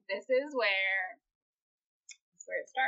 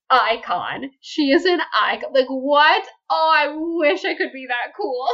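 loud, high fangirl outburst; gushing admiration; 7 semitones higher, 10 dB louder than usual. Real, not acted. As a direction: gush in awe, voice soaring. Host gushes over character's comeback.

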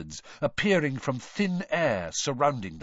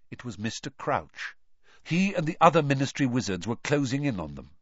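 ordinary delivery

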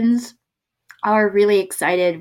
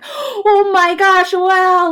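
much higher, louder and slow amazed gush; hammed-up awestruck local; amazed gasp; 12 semitones higher, 8 dB louder, much slower than usual. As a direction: gush like a stranger stunned by one word. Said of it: "the Korean person is like".

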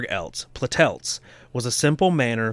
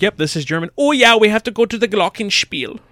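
high-pitched fake German accent, louder; Americans' fake German; 10 semitones higher, 7 dB louder than usual. Mocking Americans who fake German, high and loud. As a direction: squeak it like Americans faking German. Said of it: "they just talk really high-pitched".

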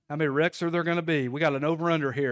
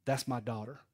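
tender reassurance; 2 semitones lower, 5 dB quieter than usual. Acted. God as dad to a struggling child, tender reassurance. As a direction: say it quietly, pausing between each promise. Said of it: "saying the exact same thing".

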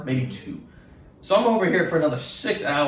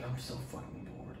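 whispered boredom, no voice at all; 3 semitones lower, 20 dB quieter than usual. Retold or imitated. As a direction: sigh it out under your breath. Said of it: "I was like".

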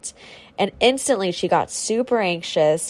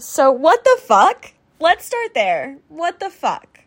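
exasperated outburst; 8 semitones higher, 6 dB louder than usual. Real, not acted. Outrage at friend's news.

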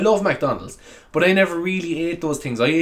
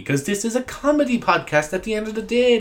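ordinary delivery

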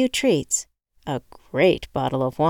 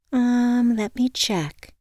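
receptionist; 7 semitones higher, much slower than usual. A narrator's reading. Receptionist voiced higher on phone.

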